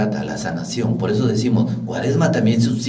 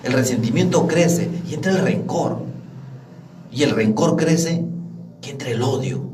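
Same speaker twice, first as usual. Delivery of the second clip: preaching at full force; 5 semitones higher than usual. Acted. Preacher rousing the congregation, full-force preaching. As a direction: preach it, climbing higher each line.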